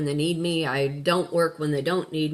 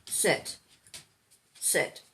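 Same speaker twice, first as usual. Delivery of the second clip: quiet dog command; 5 dB quieter than usual. Real, not acted.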